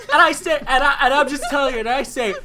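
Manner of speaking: loud laughing retelling; loud, laughing quote of her ending. Real, not acted. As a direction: laugh and shout it out.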